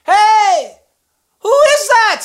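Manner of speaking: high, loud call into the dark. Acted. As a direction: call out to a stranger far away. Fox calling out in darkness.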